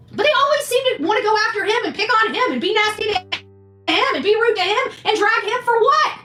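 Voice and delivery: ordinary delivery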